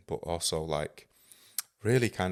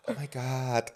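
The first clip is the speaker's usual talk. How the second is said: put-on a gushing American accent, higher; 6 semitones higher, slower than usual. Mocking yoga crowd in an American accent. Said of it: "I always do it in American accent".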